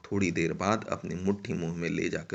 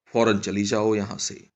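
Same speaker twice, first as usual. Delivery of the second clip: loud, menacing order to leave; raised-voice constable's threat; threatening; 7 dB louder than usual. Acted. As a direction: order her off sharply and loudly. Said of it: "धमका कर कहा".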